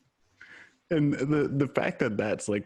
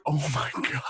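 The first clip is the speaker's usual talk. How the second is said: pitched-up mock gasp of shock; 4 semitones higher, faster than usual. Retelling a movie scene to a friend, shocked. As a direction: gasp it like you can't believe it.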